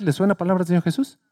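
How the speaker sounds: ordinary talk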